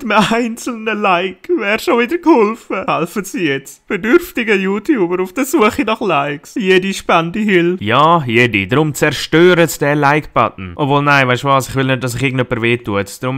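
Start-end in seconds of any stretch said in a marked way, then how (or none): 0.0-7.8 charity ad voice. Acted. Parody charity ad.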